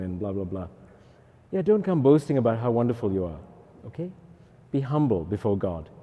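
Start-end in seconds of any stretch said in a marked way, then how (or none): none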